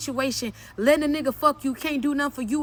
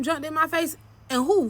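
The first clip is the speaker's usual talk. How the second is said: usual speaking voice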